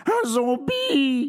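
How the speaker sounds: high, slow, terrified yell; panicked scream. Acted. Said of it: "hurle paniqué Pedro".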